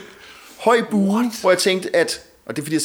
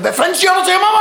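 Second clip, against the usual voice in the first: much higher, louder mock confrontation; retold angry shout; 13 semitones higher, 6 dB louder, faster than usual. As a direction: square up and yell it back. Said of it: "rejste jeg mig og sagde".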